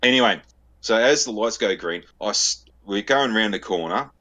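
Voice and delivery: normal talking voice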